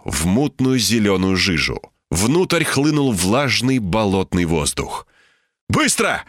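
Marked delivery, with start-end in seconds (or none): 5.7-6.3 high, urgent one-word order; shouted command. A narrator's reading. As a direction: cry out the order, pitch up. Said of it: "крикнул я". (резкий выкрик повыше)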